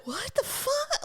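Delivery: high, drawn-out incredulous animal voice; mock-indignant critter, very high; voiced beaver. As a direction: squeak it slowly as the wronged beaver. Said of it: "the thing just went".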